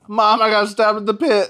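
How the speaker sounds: loud, put-on cry to Mom; mock stabbing victim. Kid faking a stabbing to fool mom, mock distressed cry.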